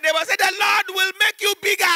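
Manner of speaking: high, shouted church greeting; man shouting a blessing at the lady. Retold or imitated. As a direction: yell the blessing across the pew.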